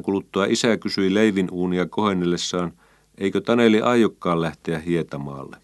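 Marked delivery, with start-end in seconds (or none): none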